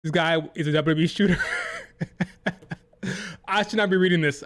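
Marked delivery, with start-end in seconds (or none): 1.4-2.7 sudden high-pitched disbelieving laugh; high burst of laughter. Real, not acted. Reading a ridiculous Reddit comment to the chat, high incredulous laugh. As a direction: burst out laughing at how absurd it is.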